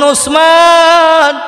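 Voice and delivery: long drawn-out high, loud call. Retold or imitated. Prophet in the preacher's story calling his companion, drawn-out high call. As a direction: stretch the name out like a summons.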